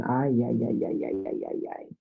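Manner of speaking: low, soft string of ay-ays fading away; dismay dying away under the breath; dismayed muttering. Real, not acted. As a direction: let it sink and trail into near silence. Dismayed reaction to an awful accent.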